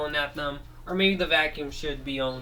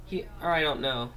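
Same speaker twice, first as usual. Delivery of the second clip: plain reading voice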